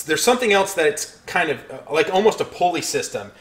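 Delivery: usual speaking voice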